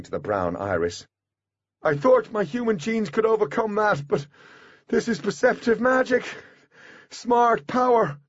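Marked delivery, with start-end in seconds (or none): 1.8-8.2 high, strained calling voice; desperate raised voice; trapped Artemis, urgent. A narrator's reading. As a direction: explain urgently through a barrier.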